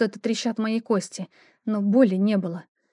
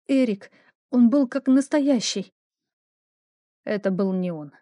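ordinary delivery, nothing marked

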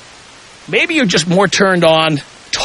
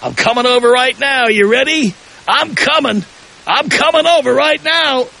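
eager caller; 7 semitones higher than usual. Retold or imitated. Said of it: "Somebody calls up and says".

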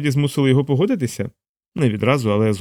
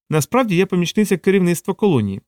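higher assistant voice, patiently explaining; 6 semitones higher than usual. A narrator's reading. Assistant correcting her startled boss, patient higher voice. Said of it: "терпляче пояснила Савітрі".

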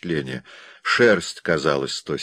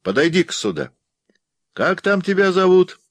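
high-pitched, raised beckoning call; calling out louder; 12 semitones higher, 4 dB louder than usual. A narrator's reading. Bill beckons the wolf and asks its name, calling louder. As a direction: call the animal over, rising high and loud at the end. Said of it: "Его товарищ крикнул громче".

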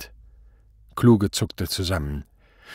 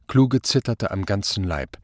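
ordinary delivery

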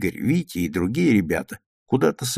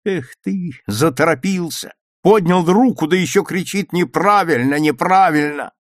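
raised, higher telling-off; scolding reproach; 5 semitones higher, 5 dB louder than usual. A narrator's reading. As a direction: berate a classmate and mimic his cry. Classmate scolds Petya, mimicking his cry. (укоризненно, передразнивая)

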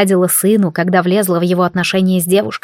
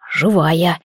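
exhaled relief, lower; breathed out; 4 semitones lower than usual. Acted. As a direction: breathe the word into her hair. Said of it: "выдохнул мне в макушку".

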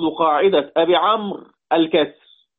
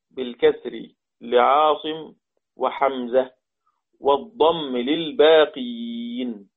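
slow, lower-pitched, drawn-out phrases; slow dictation; 4 semitones lower, slower than usual. Shown as a demonstration. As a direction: dictate it phrase by phrase, stretching the endings. Teacher dictating the rule to students. (إملاء بطيء بصوت منخفض الطبقة)

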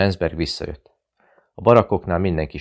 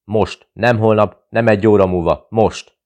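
insistent angry demand; 4 semitones higher, 7 dB louder than usual. Acted. Zilics insists angrily.

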